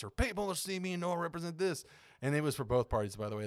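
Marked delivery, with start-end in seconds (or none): 0.1-1.8 higher-pitched mock country accent. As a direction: play the other country voter, pitched up. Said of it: "they're both country accents".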